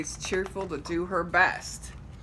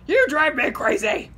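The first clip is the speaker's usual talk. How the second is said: loud angry yell, higher; 8 semitones higher, 8 dB louder than usual. Acted. Driver Shifty yells at noisy kids, loud angry yell. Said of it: "he yelled".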